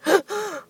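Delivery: high, sobbing gasps; imitated crying gasps. Imitating a little boy having a tough time, with high crying gasps. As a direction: mimic a child gasping through tears. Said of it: "He's like, you know, going".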